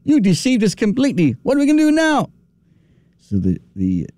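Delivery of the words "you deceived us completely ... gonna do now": high, rising panicked cries; mock-hysterical crowd, pitched up; hysterical animals. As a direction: panic climbing as the question hits. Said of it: "the animals went into hysteria".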